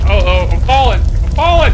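loud, rising cry of alarm; loud, panicked yell while falling. Acted. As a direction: shout as the ground gives way. Character falling into hole.

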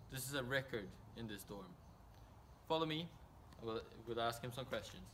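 mock TV reporter read, upbeat and measured; news reporter. Reporter hands back to the studio in a news read. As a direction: play the roving field reporter on air.

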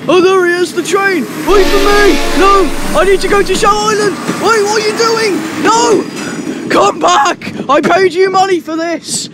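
high loud desperate calls; shouting after the train. Real, not acted. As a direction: yell after a train that won't stop. Chasing the leaving train.

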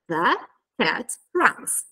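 slow, gaps between THE, CAT and RUNS; word-by-word. Shown as a demonstration. Teacher giving the example answer, word by word. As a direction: set out THE, CAT, RUNS one at a time. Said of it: "Per esempio".

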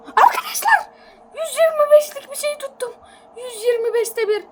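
very high, loud excited call; excited cry. Real, not acted. Telling viewers about a huge catch, very high excited cry. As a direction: shout the good news to viewers.

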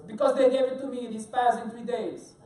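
bad French accent, loud at the start; Bruno. Chef explaining his food problem, mock French accent. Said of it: "This is my bad French accent".

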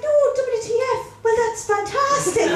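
high-pitched, gleeful mam impression; mother's cheerful explanation. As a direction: gush proudly as the clueless mother, stretching the end. Mother explains her abbreviation, acted.

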